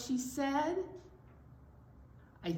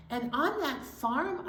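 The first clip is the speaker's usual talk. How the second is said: ordinary delivery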